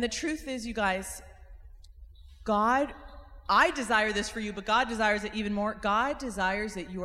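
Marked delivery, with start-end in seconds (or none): none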